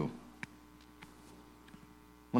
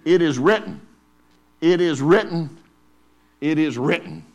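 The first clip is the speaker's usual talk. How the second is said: "it is written" hammered, louder, higher; repeated declaration; 5 semitones higher, 8 dB louder than usual. Acted. Preacher voicing Jesus answering the devil, emphatic. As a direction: strike each "it is written" like a blow. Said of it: "And Jesus answered him".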